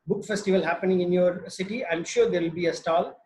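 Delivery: ordinary delivery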